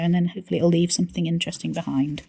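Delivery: ordinary talk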